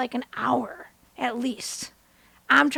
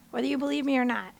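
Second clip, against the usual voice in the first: ordinary talk, nothing marked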